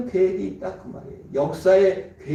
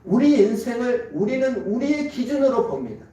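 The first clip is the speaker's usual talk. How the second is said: ordinary talk, nothing marked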